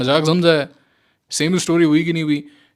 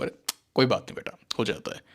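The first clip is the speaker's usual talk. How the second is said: put-on much softer, fondly soothing a child; coaxing the market's voice; 5 semitones lower, 10 dB quieter, faster than usual. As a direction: pamper the trader like a kid. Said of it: "पुचकारता है market आपको". (पुचकारती हुई धीमी आवाज़)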